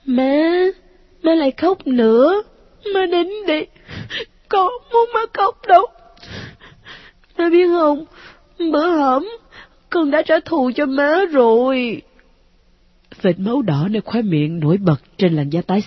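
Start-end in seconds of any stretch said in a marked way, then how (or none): 0.0-12.0 put-on high child voice, pleading then bitter; a wounded boy begging his mom; hurt ghost child. A narrator's reading. Ghost son begs mother not to send him back, high child voice.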